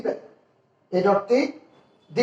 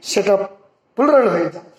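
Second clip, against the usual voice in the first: usual speaking voice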